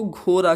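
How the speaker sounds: घोड़ा said ghora, with a tapped r instead of the retroflex ड़; Bihari r for ड़. Retold or imitated. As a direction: imitate how Biharis say घोड़ा with a plain r. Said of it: "ड़ को वो र बोलते हैं".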